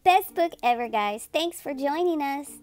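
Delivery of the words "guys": ordinary delivery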